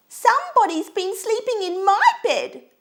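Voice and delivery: very high, loud little bear squeak; squeaky Baby Bear. A narrator's reading. Baby Bear looks at his bed, high loud squeak. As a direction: squeal it like an upset little cub. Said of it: "squeaked Baby Bear".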